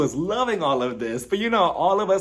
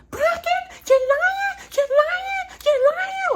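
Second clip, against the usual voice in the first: mock shriek pushed way up high, louder; super high yelling imitation; 4 dB louder, slower than usual. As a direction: squeal the accusation like a hysterical reality star. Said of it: "her voice just went up so high and she started yelling".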